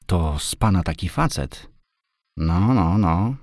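understated, impressed murmur of approval; a touch of wondering admiration; quiet admiration. A narrator's reading. Girl impressed by her rescuer, mildly admiring. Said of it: "rzekła cicho, ale z wyraźnym podziwem".

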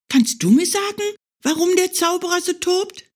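high, quick bunny question; a small hare politely curious; curious little hare. Acted. Hare asks the old oak, high and curious.

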